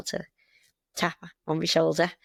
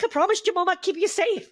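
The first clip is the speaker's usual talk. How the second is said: higher and louder, alarmed and pleading; panicked plea; 11 semitones higher, 3 dB louder than usual. Retold or imitated. A volunteer mum warning kids climbing the ferry rail, panicked. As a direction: sound the panic of a worried carer.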